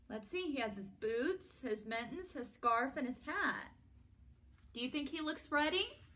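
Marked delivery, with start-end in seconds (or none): none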